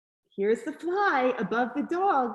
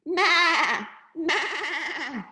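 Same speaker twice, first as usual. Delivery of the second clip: higher, quivering goat bleat; 4 semitones higher, 3 dB louder than usual. A narrator's reading. Reader voicing the goat for a child, quivering bleats. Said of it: "said the goat".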